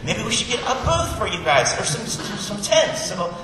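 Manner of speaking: lightly acted, butting in with a half-formed idea; eager Peter interrupting. Peter barging in on Jesus and the prophets, eager babble. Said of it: "Peter wants to open his mouth".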